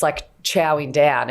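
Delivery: normal talking voice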